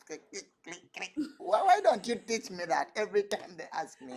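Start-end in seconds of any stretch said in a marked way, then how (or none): 0.1-1.1 dance clicks. Shown as a demonstration. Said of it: "we have a very funny dance".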